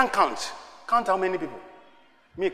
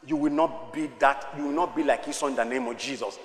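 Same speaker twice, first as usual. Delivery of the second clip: normal talking voice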